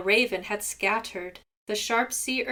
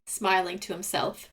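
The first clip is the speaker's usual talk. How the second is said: plain reading voice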